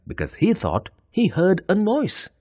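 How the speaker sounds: plain reading voice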